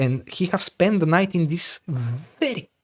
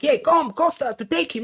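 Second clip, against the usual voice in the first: higher, hollered call to a fellow guard; mock guard yell; 9 semitones higher, 4 dB quieter than usual. Guards shouting for help with the naked man, high calling voice. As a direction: call out for Costa, raised and high. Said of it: "they're screaming like".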